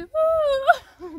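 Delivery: high, slow, loud retold cry for help; long drawn-out call "ouh". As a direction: wail out a long "ouh" from the ladder. Non, c'était moi.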